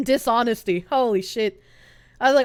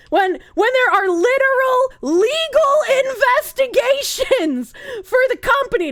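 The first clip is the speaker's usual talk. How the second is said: rising anger; 10 semitones higher, 5 dB louder than usual. Real, not acted. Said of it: "I'm so angry".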